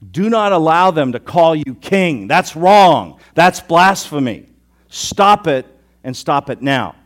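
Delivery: loud, indignant scolding; angry Pharisee commanding Jesus to stop; irritated demand. Acted. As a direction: rebuke him like an outraged Pharisee. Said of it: "Did I sound irritated there?".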